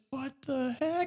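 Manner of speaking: high, soft startled what the heck; sudden alarm at the siren. Retold or imitated. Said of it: "I'm just like".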